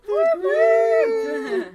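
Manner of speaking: shrieked party cheer; high excited whoop. Acted. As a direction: whoop as loud and high as you can. Show intro hype.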